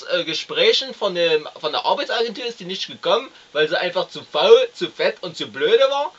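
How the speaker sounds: blustering boor ranting about his wife; crude husband. Acted. Plays the crude husband Herr M.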